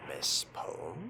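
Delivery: usual speaking voice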